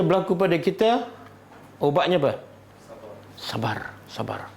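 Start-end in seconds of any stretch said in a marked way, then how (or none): 3.4-4.5 a soft, calming plea to be patient; soft repeated word. Real, not acted. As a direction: drop to a much softer, lower voice. Preacher urging patience softly.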